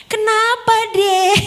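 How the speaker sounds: put-on high, loud cooing to a child; exaggerated high-pitched passerby voice; concerned passerby. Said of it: "Orang yang lewat-lewat dong".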